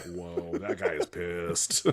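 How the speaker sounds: low, hushed, drawn-out amazement; slow, understated mock awe with a laugh in it. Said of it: "You're like".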